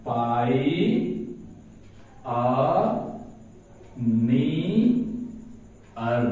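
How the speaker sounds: "पाई, आ, नी, अर" with very long pauses; syllable by syllable. Shown as a demonstration. Teacher writing out the pronunciation, syllable by syllable. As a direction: dictate "पाई, आ, नी, अर" piece by piece. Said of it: "इसका उच्चारण बड़ा बढ़िया से".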